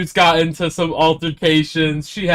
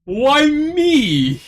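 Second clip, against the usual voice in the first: indignant why me; 10 semitones higher, 4 dB louder than usual. Acted. Player protests teasing threat.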